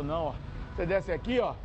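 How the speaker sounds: usual speaking voice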